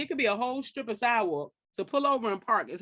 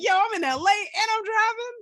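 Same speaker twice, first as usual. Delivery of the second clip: thrilled boast; 12 semitones higher, 5 dB louder than usual. Retold or imitated. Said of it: "I'm like".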